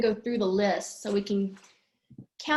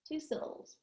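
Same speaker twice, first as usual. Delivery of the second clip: usual speaking voice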